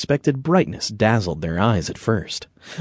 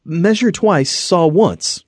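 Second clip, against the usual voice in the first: high-pitched, spoken up to be heard; raised boy voice, mildly loud; repeated loudly; 9 semitones higher, slower than usual. A narrator's reading. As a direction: repeat it clearly and high for a listener who missed it. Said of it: "he repeated more loudly".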